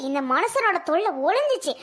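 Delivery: ordinary delivery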